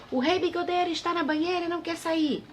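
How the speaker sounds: voz bem aguda de pajem aflito; pajem gritando. A narrator's reading. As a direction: repita o aviso agudo e aflito. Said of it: "E aí ele gritou". (pajem avisando aflito)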